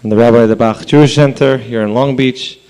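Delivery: ordinary talk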